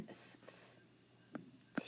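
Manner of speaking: "th" as a soft voiceless breath, no vowel; isolated th sound. Shown as a demonstration. Teacher sounds out the end of south, isolated th sound. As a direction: blow out "th" without naming letters. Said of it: "Then the ow sound in the middle".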